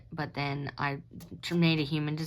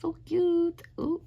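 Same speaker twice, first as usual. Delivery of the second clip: cutesy coo; 10 semitones higher, slower than usual. Real, not acted. Cooing over an old drawing.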